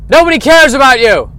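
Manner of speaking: high, loud shouted line; shouting. Acted. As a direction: yell it at the audience. Shouted punchline.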